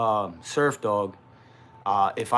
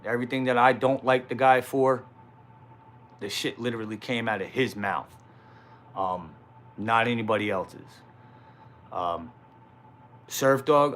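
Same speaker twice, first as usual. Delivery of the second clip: normal talking voice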